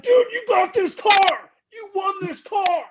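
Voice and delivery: high, loud excited shout; excited crowd shout. Retold or imitated. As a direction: yell the news at a winner. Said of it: "everyone was like".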